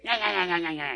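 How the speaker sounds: mocking demonic cackle; imitated infernal laugh. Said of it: "Ele ria aquela risada infernal".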